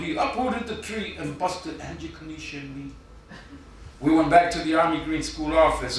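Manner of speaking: deadpan spoken-word poem read to a crowd; poem recital. Acted. Poet reading his contest entry to the judges, mock-solemn recital.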